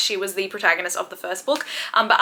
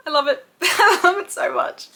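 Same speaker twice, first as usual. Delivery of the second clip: laughing gush; 10 semitones higher than usual. Real, not acted. Vlogger laughing as she gushes about her favorite book. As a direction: laugh out your love for it.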